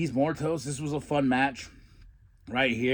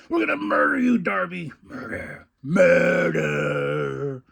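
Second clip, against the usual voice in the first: high, loud threat then a breathy murder; hammed-up villain promo; menacing promo voice; 3 semitones higher, 5 dB louder, slower than usual. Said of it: "They're like".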